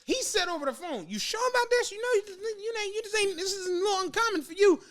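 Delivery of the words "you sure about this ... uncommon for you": high-pitched, hesitant questioning; stammering disbelief, pitched well up; doubtful concern. Retold or imitated. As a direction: imitate a wary friend checking up. Retold wary friend on the phone.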